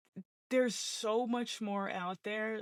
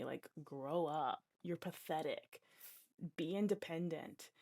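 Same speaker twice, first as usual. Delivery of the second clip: scornful; 4 semitones lower, 6 dB quieter, slower than usual. Retold or imitated. Mocking her old cynical self.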